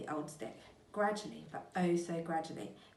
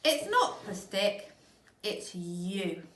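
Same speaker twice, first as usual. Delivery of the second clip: slow, louder mean grin; grinning horribly; 2 semitones higher, 7 dB louder, much slower than usual. Acted. Mister Twit tormenting his wife, grinning horribly. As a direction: relish the cruel trick. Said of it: "grinning horribly".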